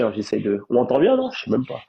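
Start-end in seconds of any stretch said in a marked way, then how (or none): none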